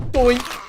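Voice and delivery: loud, high cartoon bonk noises; doink sound effect. Gamer bonking zombie heads, loud high cartoon doinks. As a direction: punctuate each hit with a silly bonk.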